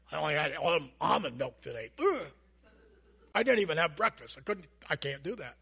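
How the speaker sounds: low, sulky moaning complaint; whiny complainer. Pretend. Coworker asked what's wrong, low sulky whine about almond milk. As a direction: pout through the whole sad breakfast story.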